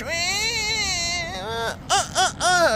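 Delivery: high fake sobbing whimper; mock whimpering. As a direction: whimper like a crybaby. Mocking sticker with fake crying.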